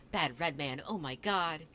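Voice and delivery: ordinary delivery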